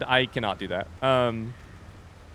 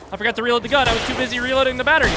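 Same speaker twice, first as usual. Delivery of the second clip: high, loud, rushed panic; panicked; 12 semitones higher, 8 dB louder, much faster than usual. Real, not acted. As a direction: blurt it out in panic. Panics during fight.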